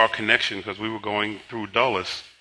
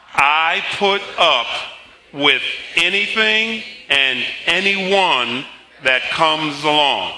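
very slow, raised, phrase by phrase; deliberately slow; 6 semitones higher, 5 dB louder, slower than usual. Shown as a demonstration. Pastor redoing a line for the congregation, slow emphatic recitation. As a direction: spell it out slowly for everyone to follow. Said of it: "let's say that slowly".

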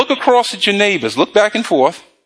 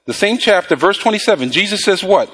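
usual speaking voice

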